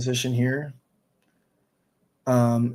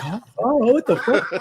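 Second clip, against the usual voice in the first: mock surprise; 11 semitones higher than usual. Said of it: "and they're like".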